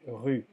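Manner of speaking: "rue" con u francesa cerrada y erre de garganta; rue en francés. Shown as a demonstration. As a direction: di "rue" redondeando los labios. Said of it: "pero se dice". (la profesora enseña cómo se dice "rue")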